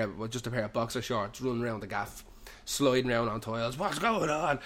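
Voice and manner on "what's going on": high, mock-frantic cry; retold startled shout, pitched up; panicked questioning. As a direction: call it out in acted alarm. Retold panicked shout running round house.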